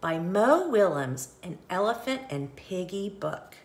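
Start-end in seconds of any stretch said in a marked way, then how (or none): none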